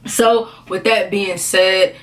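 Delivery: usual speaking voice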